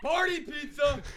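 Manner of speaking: high, drawn-out loud call; scream. Shown as a demonstration. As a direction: belt it out slowly like a kitchen order. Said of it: "I'm gonna scream".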